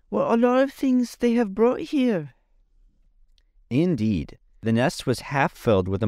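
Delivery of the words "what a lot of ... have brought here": Tip's voice. A narrator's reading. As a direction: pitch up high and draw it out. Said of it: "he continued".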